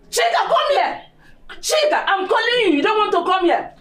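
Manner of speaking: angry shout. Acted. Mother yelling for her daughter Chika, furious shout. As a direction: shout for her across the house.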